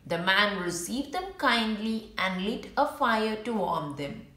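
ordinary delivery, nothing marked

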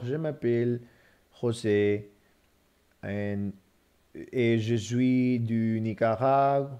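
slow, halting French with an English accent; feed the phone one careful phrase at a time; broken French. Shown as a demonstration. Retrying French dictation to the phone, slow and halting. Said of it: "try French with my broken French".

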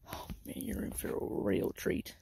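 sudden low breathy whisper; odd whisper. Real, not acted. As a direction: drop into a secret whisper. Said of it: "Why am I talking like this?".

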